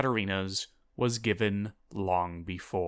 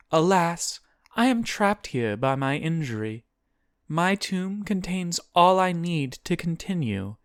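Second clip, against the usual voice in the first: high-pitched, solemn demon; demon's high, portentous farewell; 7 semitones higher than usual. A narrator's reading. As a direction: lament your confinement, then dismiss the little sister. Voices demon sending Guinevere off.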